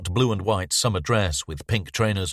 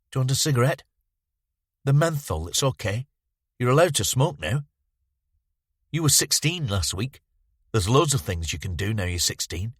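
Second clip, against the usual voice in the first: lightly voiced pleading boy, higher; eager boy; 6 semitones higher than usual. The boy offering Bowman a cigarette, lightly voiced eager plea. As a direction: coax him like a needy kid.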